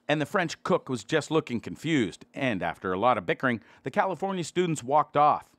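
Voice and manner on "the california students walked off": ordinary delivery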